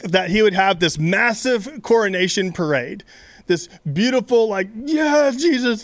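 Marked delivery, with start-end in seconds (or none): 4.8-5.8 cheering. Pretend. Imagined crowd cheering coronation parade.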